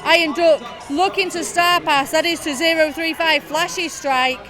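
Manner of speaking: loud, driving live commentary; play-by-play commentary. Acted. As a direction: call the action as it happens.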